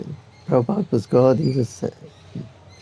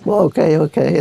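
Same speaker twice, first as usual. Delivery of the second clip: higher, louder mock concession; acted giving in; 5 semitones higher, 4 dB louder than usual. Challengers shown the notepad back down, acted giving in. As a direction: give in quickly, hammed up.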